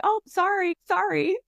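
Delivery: high, quick apologetic "Oh, sorry, sorry"; Midwest reflexive sorry. Shown as a demonstration. Guest acting out a polite Midwesterner bumping into someone, high apologetic. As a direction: chirp a reflex apology as you squeeze past. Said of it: "we say".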